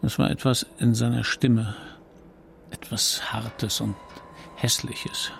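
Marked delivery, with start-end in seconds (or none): 2.7-5.3 hushed and slow, almost a whisper; low, unsettled whisper. A narrator's reading. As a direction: lean in and breathe the words. Narrator lowers to whisper.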